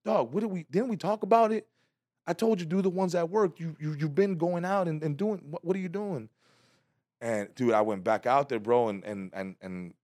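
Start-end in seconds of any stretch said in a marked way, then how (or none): 0.1-6.3 scolding friend. Retold or imitated.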